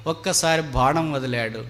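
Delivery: ordinary delivery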